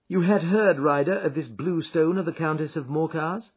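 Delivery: confident Holmes. Acted. Holmes confronts Ryder.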